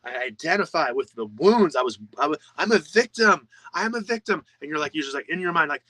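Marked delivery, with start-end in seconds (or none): 0.0-4.4 mock wail of someone clinging to their trauma; self-pitying victim. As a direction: play the victim, hammed-up and high. Voicing the wounded self.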